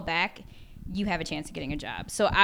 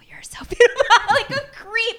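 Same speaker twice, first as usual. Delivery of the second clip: higher, louder, breaking into laughter; laughing; 12 dB louder than usual. Real, not acted. Admitting to the hosts she stared at Paul Rudd, laughing. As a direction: laugh at your own creepiness.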